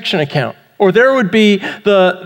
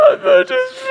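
very high-pitched, hammed-up blubbering; mock crying. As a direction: fake a helpless breakdown into sobs. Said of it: "I'm just starting to cry".

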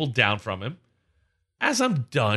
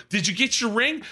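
high, indignant question; retold yelling; 8 semitones higher than usual. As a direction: throw the question at him indignantly. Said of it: "I'm yelling at this guy".

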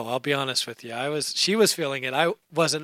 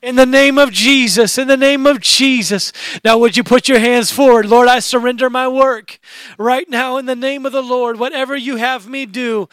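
raised, insistent praying voice, surging loud at first; fervent preached prayer; 7 semitones higher, 5 dB louder than usual. Real, not acted. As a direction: lead the congregation in impassioned prayer. Leads prayer at full force.

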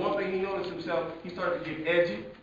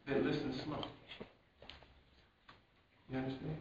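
normal talking voice

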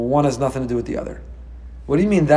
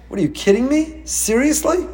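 higher, disbelieving rise; incredulous scolding; 9 semitones higher than usual. Pretend. God scolding the people, high mock disbelief. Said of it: "and saying".

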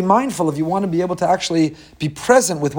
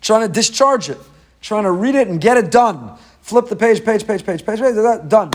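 higher, hurried rattle to get it done; rushing through it; 6 semitones higher, 3 dB louder than usual. Acted. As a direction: race through it like someone flipping pages to finish. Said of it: "trying to fly through it".

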